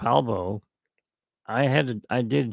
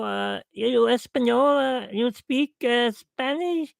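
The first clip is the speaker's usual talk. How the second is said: high, slow, halting foreign customer voice; 10 semitones higher, slower than usual. Retold or imitated. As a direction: ape the Subway customer who barely speaks English, pitched high. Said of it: "there was a lady who came in".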